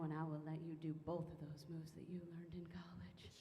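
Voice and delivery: hushed, breathy come-on; sultry whisper. Acted. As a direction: whisper it like a tempting secret. Seductive whispered promise.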